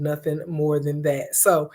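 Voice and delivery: ordinary talk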